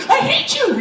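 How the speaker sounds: high, loud mock shout through laughter; frustrated yell. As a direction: scream it like a fed-up kid. Frustrated kid at dodgeball hoarder.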